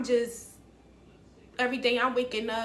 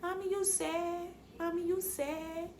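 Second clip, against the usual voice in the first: put-on small, soft, higher little-boy voice; two-year-old son; 6 semitones higher, 8 dB quieter than usual. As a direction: imitate a toddler quietly checking on mom. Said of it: "and he like".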